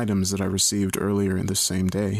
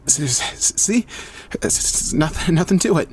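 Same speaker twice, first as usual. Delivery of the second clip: teeth-chattering stutter, higher pitched; shivering; 8 semitones higher than usual. A narrator's reading. Celeste out of the icy water, shivering and stuttering. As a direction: stammer the words through chattering teeth. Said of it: "She shivered and chattered her teeth".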